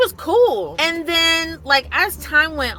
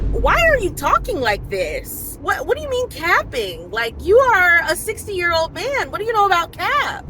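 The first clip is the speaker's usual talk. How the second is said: high-pitched retold disbelief, louder; incredulous challenge; 8 semitones higher, 4 dB louder than usual. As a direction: act out baffled indignation, pitch jumping up. Retells challenging the man's slang.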